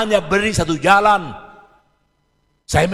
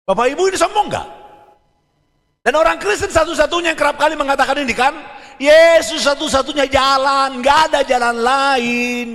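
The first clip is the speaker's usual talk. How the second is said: raised, fast, insistent sermon delivery; preaching at full force; 9 semitones higher, 5 dB louder than usual. Acted. Preacher to congregation, fast forceful preaching. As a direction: press the point home to the congregation.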